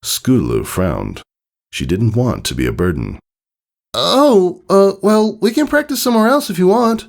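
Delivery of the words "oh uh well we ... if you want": high, unsure filly voice; a young pony backing off awkwardly; hesitant filly voice. Acted. Scootaloo hesitant.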